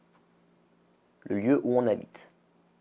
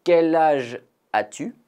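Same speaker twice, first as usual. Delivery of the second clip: bright, higher, clearly asked "Quel âge as-tu?"; teacher model question; 5 semitones higher, 4 dB louder than usual. Shown as a demonstration. Teacher modeling the question for students, higher and brighter. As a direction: ask it like a model dialogue line. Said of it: "Encore une fois".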